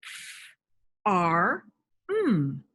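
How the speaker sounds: F, R, M said one by one; teacher showing blending; separate phonemes. Shown as a demonstration. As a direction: sound out each phoneme with pauses. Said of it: "if I give you three sounds".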